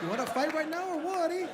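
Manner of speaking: high, hammed-up street fighter taunt; mock tough guy challenge. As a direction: pick a fight with a heckler. Threatening a Marine.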